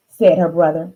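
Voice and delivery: plain reading voice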